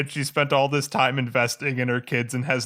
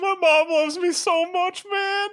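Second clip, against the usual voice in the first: very high, drawn-out fake sob; hammed-up weepy whine; mock crying; slower than usual. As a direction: blubber it slowly in a squeaky mock cry. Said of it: "he started crying".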